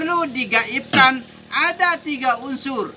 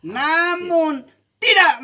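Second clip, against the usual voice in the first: slow, higher and louder on "Namun tidak", leaning hard on "tidak"; emphatic denial; 8 semitones higher, 6 dB louder, slower than usual. Real, not acted. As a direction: drag out "Namun tidak" like a preacher's firm no. Preacher refutes hypocrites emphatically. (penekanan tegas pada "tidak", pelan dan keras)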